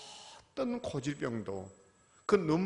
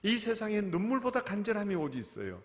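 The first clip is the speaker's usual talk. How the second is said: usual speaking voice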